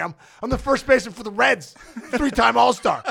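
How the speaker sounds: chuckling through the words; laughing. Real, not acted. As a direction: laugh while you say it. Bragging credentials while laughing.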